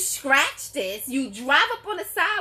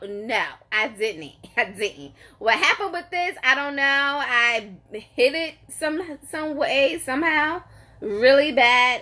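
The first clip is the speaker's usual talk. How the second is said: ordinary delivery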